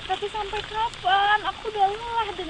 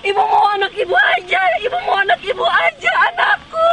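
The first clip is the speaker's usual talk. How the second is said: louder and higher, sobbing wail; wailing cry; 6 semitones higher, 13 dB louder than usual. Acted. Mother wails that she only wants her child, sobbing.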